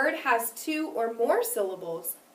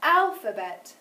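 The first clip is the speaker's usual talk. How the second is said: "Alphabet" with its first syllable louder and drawn out; stress on first syllable; 2 semitones higher, 5 dB louder, slower than usual. Shown as a demonstration. Teacher repeats for pupils to count, stressed start of "Alphabet". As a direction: punch the start of "Alphabet", ease off after. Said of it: "first syllable was louder, longer, and higher".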